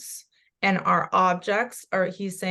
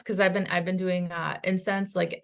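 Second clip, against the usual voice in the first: ordinary delivery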